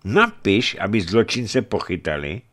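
high, loud, indignant demand; commanding bishop. Acted. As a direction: denounce the crime and order punishment.

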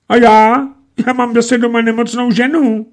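very high, trembling voice; chattering fright. A narrator's reading. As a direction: stammer out the plea. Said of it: "jektal místopředseda". (jektavě, vysoko)

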